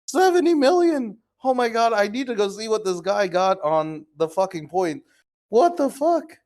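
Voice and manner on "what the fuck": shocked. Real, not acted. Checking the points again.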